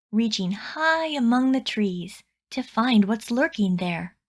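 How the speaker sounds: plain reading voice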